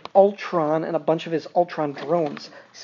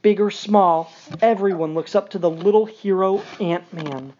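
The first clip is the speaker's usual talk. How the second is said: ordinary talk, nothing marked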